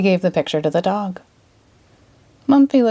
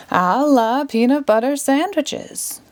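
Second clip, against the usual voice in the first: higher, slow sing-song spell; magic incantation; 7 semitones higher, slower than usual. A narrator's reading. Magician pulling a rabbit, slow grand spell. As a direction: draw out the magic words. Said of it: "magic words spell".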